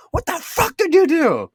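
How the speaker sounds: high, loud imitation of an angry mother; furious mom. Mom to son who smashed his car, imitated furious outburst. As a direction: screech it in hammed-up outrage.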